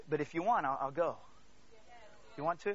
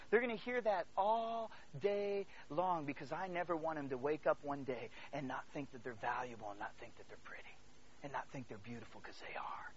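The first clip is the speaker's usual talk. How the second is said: earnest, sinking low and soft to a whisper; fading to a hush; 6 semitones lower, 7 dB quieter than usual. Real, not acted. Father speaking of his daughters' worth, hushed and tender. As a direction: let it fall away into a tender whisper.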